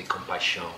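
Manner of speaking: compaixão said the Portuguese way, kom-pai-SHOWN with a nasal -ão ending. Shown as a demonstration. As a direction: give compaixão its Portuguese sound. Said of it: "In Portuguese you say".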